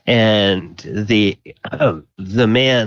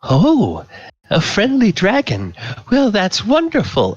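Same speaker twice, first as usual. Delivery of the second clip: much higher, delighted tipsy coo; tipsy woman; 12 semitones higher than usual. Acted. Tipsy woman delighted by a friendly dragon, much higher coo. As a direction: gush like a merry drunk at a party. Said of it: "clearly she's a little bit tipsy".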